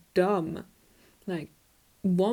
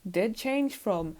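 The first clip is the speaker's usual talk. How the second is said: ordinary talk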